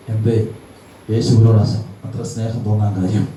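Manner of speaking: normal talking voice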